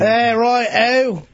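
high, put-on British voice; exaggerated British impersonation; mock British accent. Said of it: "That's more Liverpool".

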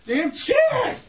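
high-pitched outburst off the mic; angry yell. Real, not acted. Man throwing chairs in the studio, angry yell. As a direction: curse the furniture at the top of the range.

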